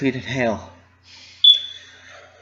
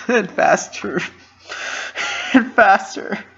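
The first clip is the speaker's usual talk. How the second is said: crying harder and harder; choked sobbing; 8 semitones higher, 4 dB louder, slower than usual. A narrator's reading. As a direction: voice rising, sob catching louder each time. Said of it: "the sob was choked out even quicker".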